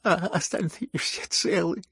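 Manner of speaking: touched and tremulously grateful; tender emotion. A narrator's reading. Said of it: "с умилением выговорил Лебедев".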